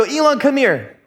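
mock stern dad calling his kid; calling over. Father summoning son about the pillow, calling out.

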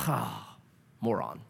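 sigh, then a low, soft "moron"; mock the fed-up father with a tired sigh; exasperated sigh. God pictured as a fed-up father, sighing low and soft.

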